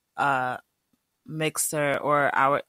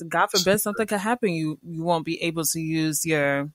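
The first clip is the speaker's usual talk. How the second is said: ordinary talk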